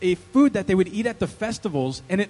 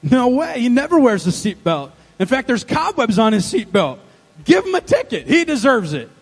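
loud, emphatic contradiction; indignant tattling wife; 3 semitones higher, 5 dB louder than usual. Acted. Wife telling the officer to ticket her husband, indignant. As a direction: gleefully throw him under the bus.

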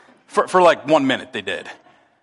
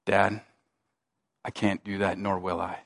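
quiet firm refusal; 11 semitones lower, 11 dB quieter than usual. Retold or imitated. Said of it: "he goes".

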